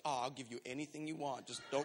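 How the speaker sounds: soft, low, uneasy pleading; mock nervous waitress. Waitress who saw the row, quietly nervous. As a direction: give in quietly, as if intimidated. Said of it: "nervous 'cause she was in the kitchen".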